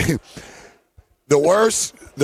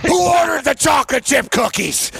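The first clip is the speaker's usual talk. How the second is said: mock outraged yell; 8 semitones higher than usual. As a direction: blurt it like a man stunned by the total. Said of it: "He's looking at the bill".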